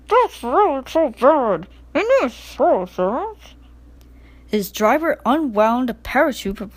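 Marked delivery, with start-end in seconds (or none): 0.1-3.5 higher, slow muffled grumble; muffled indignant. A narrator's reading. Percy under the parachute, muffled and indignant. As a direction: complain from under a sheet. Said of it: "in a muffled voice".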